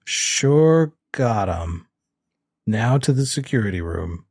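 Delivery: slow, stilted bad actor; over-deliberate, badly faked confidence; Gary. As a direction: drag out the fake line woodenly. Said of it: "'cause Gary does not".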